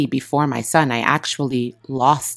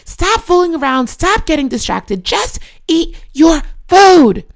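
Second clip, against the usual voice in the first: very high, slow, louder screamed orders; furious mom screaming at the top of her lungs, high and slow; retold screaming; 14 semitones higher, 6 dB louder than usual. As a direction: scream at her son over breakfast, dragging the words out. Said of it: "screaming, guys, to the top of my lungs".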